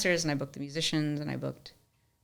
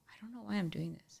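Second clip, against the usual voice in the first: hushed whisper; 6 semitones higher, 9 dB quieter than usual. Retold or imitated. Donor's words retold.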